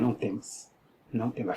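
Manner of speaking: soft, low imitation of God's voice; quietly retold reassurance; faint divine voice. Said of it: "e a voz dizendo".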